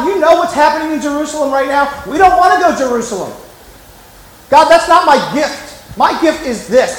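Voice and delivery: high-pitched mock protest; grumbling complaint. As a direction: act out an indignant, grumbling refusal. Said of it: "start complaining and grumbling".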